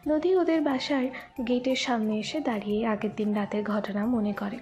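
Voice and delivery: usual speaking voice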